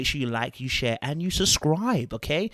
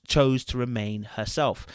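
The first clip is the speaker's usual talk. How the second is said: ordinary talk, nothing marked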